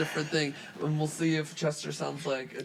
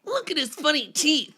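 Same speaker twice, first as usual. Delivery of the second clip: high, loud teasing taunt; mocking fish; 10 semitones higher, 5 dB louder than usual. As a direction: sneer at him like a playground bully. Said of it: "I'll read responses as voices".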